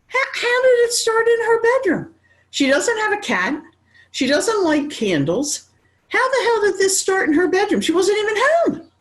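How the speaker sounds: can't believe how it started; incredulous outburst. Retold or imitated. Son voiced, disbelieving the fire.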